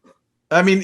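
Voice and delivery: ordinary delivery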